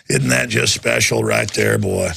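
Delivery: drip with mock folksy sarcasm; sarcastic drawl. Host mocking the document, low folksy sarcasm.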